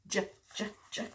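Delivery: "J, j, j." as a soft bare juh sound; "j" said as the sound only, quietly repeated; letter J sound. Shown as a demonstration. Teaching a child the J sound, "J, j, j.". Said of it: "J sounds like this".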